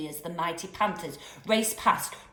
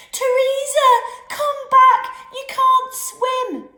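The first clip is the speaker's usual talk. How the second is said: much higher, much louder panicked scream; frantic scream; 12 dB louder, slower than usual. A narrator's reading. Kelly watching her doll fall in, frantic scream. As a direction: shriek after the lost doll. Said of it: "She sounded frantic".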